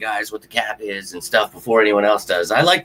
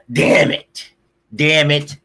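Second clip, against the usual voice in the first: raised, loud exasperated curse; frustrated cursing; 5 semitones higher, 4 dB louder, much slower than usual. Real, not acted. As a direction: curse the loss, punching each word. Cursing over the lost game.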